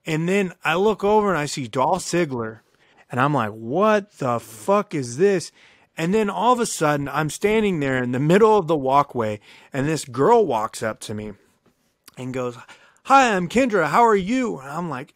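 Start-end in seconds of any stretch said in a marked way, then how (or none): 3.5-5.5 slow stunned disbelief. Retold or imitated. Stunned seeing celebrities at club. 13.0-14.6 higher, louder bubbly hostess greeting; Kendra's greeting. Retold or imitated. Kendra greets a lost stranger at her party, higher and louder.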